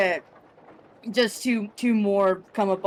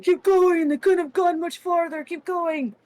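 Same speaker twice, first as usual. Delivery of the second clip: wood giant shouting; 10 semitones higher than usual. Acted. Wood giant yelling at his friends to chase the orcs. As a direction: yell at the others to keep chasing. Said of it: "the first wood giant just go".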